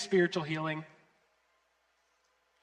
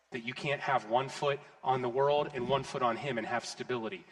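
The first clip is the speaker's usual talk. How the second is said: ordinary talk, nothing marked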